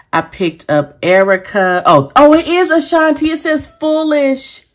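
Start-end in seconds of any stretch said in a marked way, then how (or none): 1.9-4.7 higher and louder, thrilled discovery; excited. Real, not acted. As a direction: gush with real excitement over the find. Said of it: "I'm a little excited".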